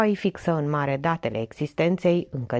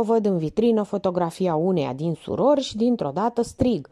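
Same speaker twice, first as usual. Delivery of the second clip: ordinary delivery, nothing marked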